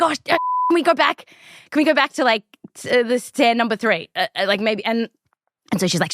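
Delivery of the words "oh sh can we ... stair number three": higher, fast, fumbling request to rewind; flustered plea. Retold or imitated. Asking the hypnotist to return to stair three, higher, flustered. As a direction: scramble to undo the mistake.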